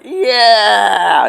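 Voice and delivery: high, loud, drawn-out yeah; victory yell. Retold or imitated. Man reliving the moment the drone fell, loud victory yell. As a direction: cheer like you just won it all. Triumphant cheer at success.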